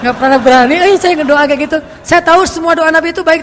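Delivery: loud, earnest self-admission; emphatic confession. Real, not acted. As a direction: admit it with force. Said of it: "earnest confession, louder".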